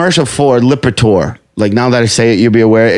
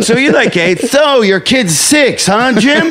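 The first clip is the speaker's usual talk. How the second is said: higher, slow cheesy talk-show host; mock host segue; 9 semitones higher, slower than usual. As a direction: drag out the clueless host question. Mocking Byron Allen's segues.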